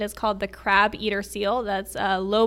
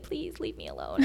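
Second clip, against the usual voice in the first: pleading whisper; 8 dB quieter than usual. Retold or imitated. At the toilet, pleading with the birds in a whisper. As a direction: hush it like you're begging to be left in peace. Retold plea to terns.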